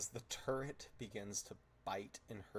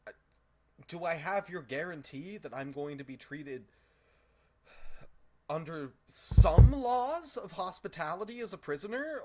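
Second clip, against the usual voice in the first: high, loud, flustered bargaining; nervous Fae voice; 8 semitones higher, 12 dB louder than usual. Acted. Captive Fae bargains with Uller, high and flustered. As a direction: bargain fast before the turret bites.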